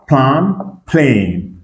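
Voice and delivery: plan with a short a, plane as "plen"; short versus long A. Shown as a demonstration. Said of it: "le son qui est court et ... qui est long".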